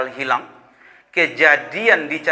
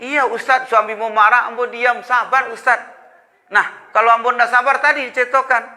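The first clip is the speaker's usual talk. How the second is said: wife explaining; 8 semitones higher than usual. Retold or imitated. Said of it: "Maksud Ibu?".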